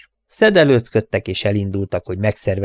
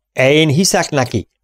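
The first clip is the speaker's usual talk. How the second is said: magasabban felkiált, öklével az asztalra csapva; kiáltás; 6 semitones higher, 4 dB louder than usual. A narrator's reading. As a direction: Ellen határozottan kiált. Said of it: "kiáltotta Ellen". (Ellen a társainak kiált, hangosan)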